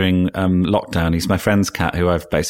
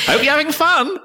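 very high, hammed-up cheery voice; mock cheery. Mocking his own greeting to the patient, very high and cheery. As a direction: squeak it out like a clueless celebrity. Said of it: "it's just me being fuck-, going".

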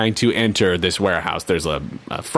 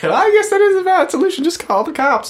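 high sarcastic mock voice; 3 dB louder, faster than usual. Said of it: "I was like".

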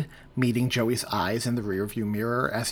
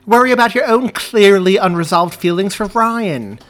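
loud, high, sharp angry jab; furious outburst in the back seat; angry retort; 9 semitones higher, 14 dB louder than usual. A narrator's reading. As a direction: snap it back at her in anger.

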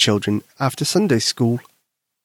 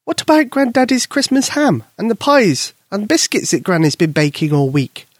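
mother voice; 8 semitones higher than usual. Acted. Mother coaxes daughter.

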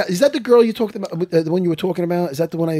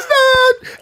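high, loud whiny "Dad"; imitating Longo; 4 dB louder than usual. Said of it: "you know how Longo talks".

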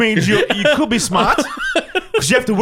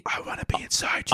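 joking whisper in someone's ear; hushed mock-intimate aside; 8 dB quieter than usual. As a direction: whisper it close, as a joke. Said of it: "And whispered in his".

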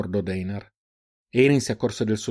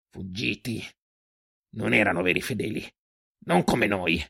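hushed, broken answer; defeated cultist; 3 dB quieter than usual. A narrator's reading. Captive without hope speaks of the unfaithful, defeated and quiet. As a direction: drop to a soft, hopeless voice.

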